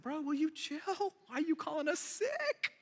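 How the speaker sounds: high, soft, slowed protest with a laugh; Matthew protesting. Retold or imitated. Matthew pleads with Jesus to ease off, high and soft. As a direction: play Matthew as a sheepish, laughing friend.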